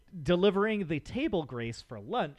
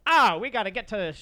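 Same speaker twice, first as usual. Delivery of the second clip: a very bad Cockney accent that drifts transatlantic, pitched up and louder; 6 semitones higher, 7 dB louder than usual. Guest recapping a sketch, in a deliberately bad Cockney accent. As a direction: throw on a wobbly mock Cockney. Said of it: "your Cockney accent".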